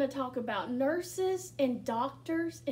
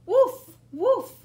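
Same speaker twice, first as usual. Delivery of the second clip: loud barked woof like a puppy; loud playful puppy woofs; dog bark; 9 semitones higher, 11 dB louder than usual. Acted. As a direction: bark it out like Biscuit. Dog barks in story.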